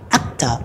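"Actor" with a clear t after the k sound; unchanged T. Shown as a demonstration. Teacher shows T stays after a consonant, clear T in "Actor". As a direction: keep the t crisp in "Actor". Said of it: "đọc bình thường".

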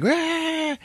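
high yelped Ah; quick hammed-up fright cry; mock scream. Kid startled by a falling skull, mock shriek.

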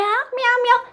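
high mock meowing; cat meow. Shown as a demonstration. Teacher meowing for the toddler, high. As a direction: meow like a kitty for a child. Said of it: "imitating a cat".

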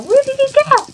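mock high squeaky question; imitating own confused self. Gamer mocking her own earlier confusion, squeaky and high. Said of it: "I was all".

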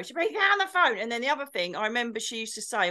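usual speaking voice

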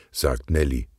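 ordinary delivery

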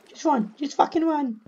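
high-pitched panicked voice; panicked urging. Real, not acted. Player telling themselves to flee, panicked urging. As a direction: urge yourself on in a high panic. Fleeing a mob in the game.

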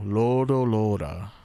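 "Lodo lora" with weight on each held "lo"; accent on long vowel. Shown as a demonstration. Explaining the accent rule, stretched stressed "Lodo lora". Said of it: "on the long vowel".

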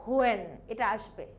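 normal talking voice

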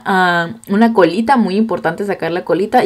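normal talking voice

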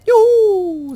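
loud high cheer of joy. Real, not acted. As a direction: shout it out in delight. Cheering in the game.

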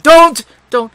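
sharp, loud don't; urgent warning. A narrator's reading. Narrator stopping the girl from touching him, loud and urgent. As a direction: stop her hand fast.

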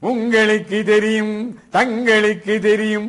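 high, loud, slow oratory; loud declamation. Shown as a demonstration. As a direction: proclaim it with the last word stretched. Demonstrating a loud Tamil speech.

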